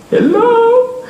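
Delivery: very high sing-song Hello; a shrill mocking Hello in English. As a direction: chirp Hello in English like a cartoon. Mock greeting to the bank.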